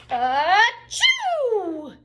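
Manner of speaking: loud high bear sneeze; big sneeze. Acted. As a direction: explode into a huge achoo.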